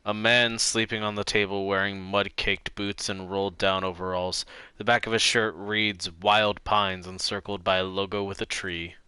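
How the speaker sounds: calm narrator read of game text; game narration read. Acted. Streamer reading the detective game text aloud, calm narration. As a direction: read the scene to viewers, calm and even.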